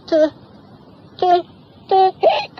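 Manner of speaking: very high stuttered hiccup; a girl choking on a hiccup. Acted. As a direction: stammer and hiccup out.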